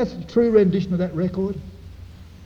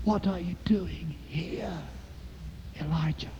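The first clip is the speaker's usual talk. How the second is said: gentle whisper; 13 dB quieter than usual. Acted. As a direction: whisper it as a still small voice. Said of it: "a gentle whisper".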